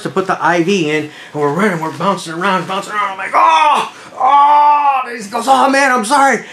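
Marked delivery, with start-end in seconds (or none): 3.3-5.0 high, loud reenacted wail; acted pained cry. Cries of pain during bumpy IV attempt. 5.5-6.4 high, raised-voice apology; acted frantic apology. Retold medic apologising amid the screaming.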